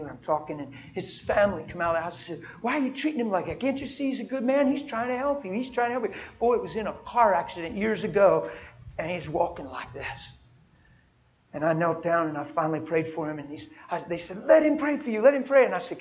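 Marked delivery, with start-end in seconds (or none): 2.6-6.1 pleading family voice. Retold or imitated. Family defends him, pleading. 14.4-15.6 much higher and louder, pressing him to let it happen; urging shout. Retold or imitated. Family urges him loudly.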